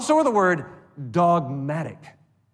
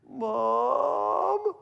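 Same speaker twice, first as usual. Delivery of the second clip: put-on high, small child's cry in the dark; child calling mom; 11 semitones higher than usual. Preacher voicing a child calling for mom at night, high and plaintive. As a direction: call out like a scared little kid.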